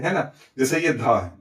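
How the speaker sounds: ordinary delivery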